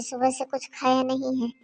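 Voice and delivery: ordinary delivery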